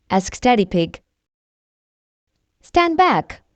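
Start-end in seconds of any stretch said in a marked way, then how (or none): 2.6-3.4 higher, urgent call from above; warning shout. A narrator's reading. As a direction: call down a warning to step back. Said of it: "shouts Miss Rabbit".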